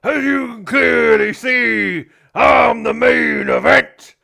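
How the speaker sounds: slow booming showman's proclamation; wrestler boast. Acted. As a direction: announce yourself as the main event. Wrestler taunts the crowd.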